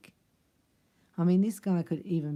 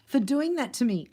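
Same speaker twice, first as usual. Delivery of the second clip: higher-pitched acted realization; dawning self-discovery, pitch lifted; sudden realization; 6 semitones higher than usual. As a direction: have it dawn on you mid-confession. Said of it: "this is what he's realizing".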